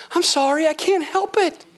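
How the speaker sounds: high-pitched playful excuse; mock helpless apology. Acts apologetic answer to critic.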